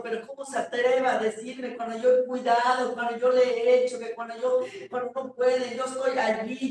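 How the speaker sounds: loud, mounting outrage, words tumbling out; indignant protest. Retold or imitated. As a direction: argue back forcefully, re-enacting the hurt. Quoting own protest to the pastor. (protesta indignada, cada vez más fuerte)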